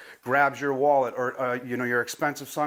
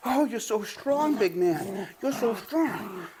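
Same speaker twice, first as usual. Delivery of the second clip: higher, slow cooing; puppy praise; 5 semitones higher, slower than usual. Real, not acted. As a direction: cheer the puppy on. Praising puppy during tug.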